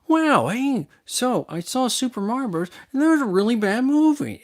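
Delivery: mock a children's host gushing at the camera; sing-song kids show host, way up high. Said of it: "like she's hosting a kids show".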